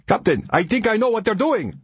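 high-pitched urgent shout, level held in. A narrator's reading. Ari to the captain mid-attack, urgent shout. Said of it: "Ari shouted".

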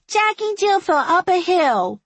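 put-on very high, loud child's voice; excited little girl. A narrator's reading. Little girl showing off for the sheriff, excited. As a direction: chirp a garbled nursery rhyme like a toddler. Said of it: "laughing, arms flung wide".

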